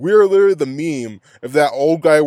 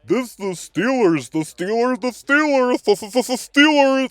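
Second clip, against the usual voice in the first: high, mocking sportscaster voice that climbs higher with each Steelers; mocking commentators; 10 semitones higher, slower than usual. Fan mocking the TV commentators, in a climbing mock voice. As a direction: parrot the announcers, fixated on Steelers. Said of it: "the fucking commentators are like".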